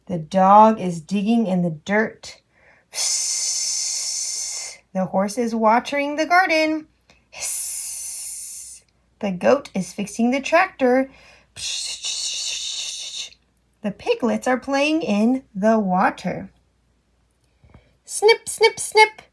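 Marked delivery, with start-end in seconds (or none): 2.9-4.8 soft hissing spray noise; spraying psst. Pretend. Hose spray sound. 7.3-8.8 quiet airy hissing noise; soft hiss. Pretend. Hose hiss sound. 11.6-13.4 quiet hissing spray; soft spray psst. Pretend. Spray sound. 18.0-19.2 higher, crisp playful snips. Pretend. Scissors sound for child.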